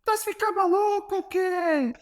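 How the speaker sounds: very high-pitched mock yell, imitating an angry coach; Jorge Jesus shouting. Coach yells at his player from midfield, mock high-pitched shout. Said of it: "ele grita assim".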